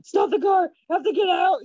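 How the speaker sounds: high, loud mock-desperate demand; hammed-up yell from the back seat; retold urgent shout. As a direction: call out to the driver in mock panic. Retells begging sister to stop car.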